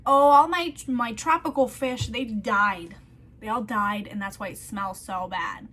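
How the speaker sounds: loud, hammed-up fake panic; mock distressed excuse. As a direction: oversell the lie to the manager. [name]'s fake excuse about fish.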